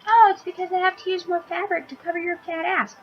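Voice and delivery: high, sneering mock voice of a clerk; mocking store voice. Mocking the clothing store's excuse to plus size women, high and sneering.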